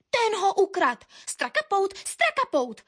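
high, repeated accusing cries; parroting crowd. A narrator's reading. All the birds echo the accusation, high parroting. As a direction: echo the accusation as an eager flock. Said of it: "papouškovali hned všichni".

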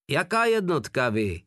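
lightly voiced Russian speaking poor German; Russian, broken German. A narrator's reading. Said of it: "zeptal se Rus bídnou němčinou".

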